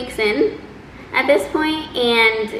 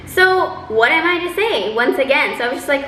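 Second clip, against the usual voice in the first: normal talking voice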